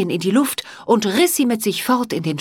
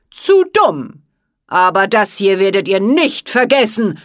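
shouted; 7 dB louder than usual. Acted. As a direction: scream the threat. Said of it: "schrie er".

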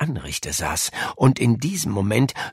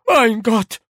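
very high, loud horrified cry; horrified housekeeper; 14 semitones higher, 6 dB louder than usual. A narrator's reading. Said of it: "Die Haushälterin wechselte die Gesichtsfarbe". (entsetzter Ausruf, hoch und laut)

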